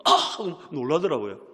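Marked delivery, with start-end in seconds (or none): 0.0-0.1 게임하다 터진 짧은 고함 흉내; 깜짝 놀라 내지르는 "아!"; 큰 외마디 소리. Retold or imitated. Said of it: "큰 소리로 막". (갑자기 크게 지르는 소리)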